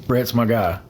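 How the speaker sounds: ordinary talk, nothing marked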